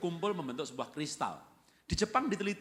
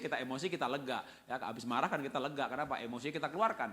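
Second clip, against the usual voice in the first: ordinary delivery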